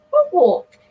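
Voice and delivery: sudden surprised question. Retold or imitated. Asking the girl about his walk, surprised. Surprised retort in retold chat.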